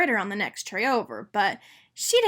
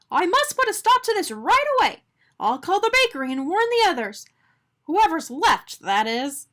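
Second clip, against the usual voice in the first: high, loud urgent resolve; rally to action fast; determined; 7 semitones higher, 5 dB louder than usual. A narrator's reading. Arnie resolves to warn others.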